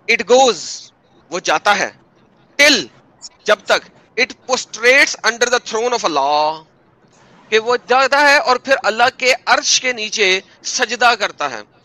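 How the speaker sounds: loud, theatrical mock recital; sarcastic, over-dramatic scripture reading. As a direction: read it out loudly with mocking emphasis. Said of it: "आपकी tone ऐसी sarcastic आ रही थी".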